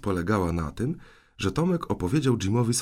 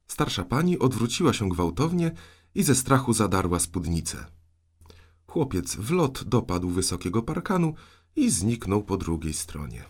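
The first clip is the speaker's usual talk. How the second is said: plain reading voice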